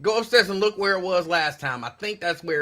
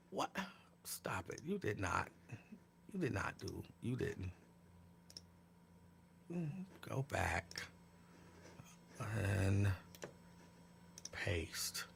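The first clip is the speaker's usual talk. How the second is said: low breathy whisper off mic; whispered aside; 9 semitones lower, 20 dB quieter, slower than usual. Real, not acted. Aside to someone off camera, whispered. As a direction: drop to a whisper so viewers miss it. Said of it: "whispered aside off camera".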